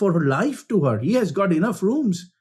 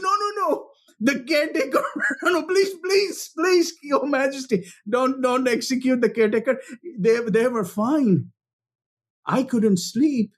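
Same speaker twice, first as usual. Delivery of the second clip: much higher, frantic begging with a nervous laugh; frantic pleading; 7 semitones higher than usual. Retold or imitated. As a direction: beg the king to spare him. Frightened old woman pleads.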